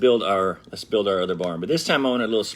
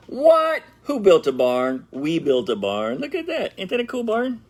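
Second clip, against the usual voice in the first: much higher, slow amazed exclaim; hammed-up surprise; 9 semitones higher, 3 dB louder, slower than usual. Showing kids the finished barn, hammed-up amazement. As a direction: act astonished for the kids.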